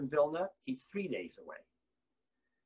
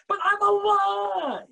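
high, loud, slow proclamation; triumphant cry; 14 semitones higher, 8 dB louder, much slower than usual. Real, not acted. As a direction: stretch it out like a victory. Climax declaration.

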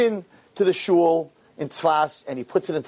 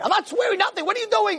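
high-pitched indignant retort; acted outraged rabbi; angry refusal. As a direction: refuse and demand an answer. Rabbi refuses, keeps accusing.

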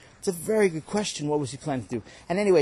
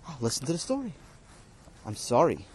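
ordinary talk, nothing marked

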